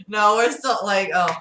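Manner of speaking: ordinary delivery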